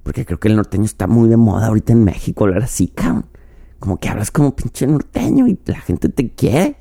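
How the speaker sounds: put-on joking northern Mexican norteño accent. Host jokes to listeners in a norteño Mexican accent. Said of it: "estoy hablando, está como norteño".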